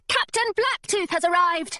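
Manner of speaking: high-pitched excited announcement; lifted, carrying call. A narrator's reading. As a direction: call it out to the crew. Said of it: "she called".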